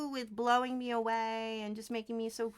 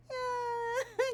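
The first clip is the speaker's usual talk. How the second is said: ordinary delivery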